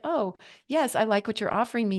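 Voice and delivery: ordinary talk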